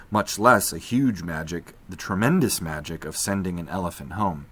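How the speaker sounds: ordinary delivery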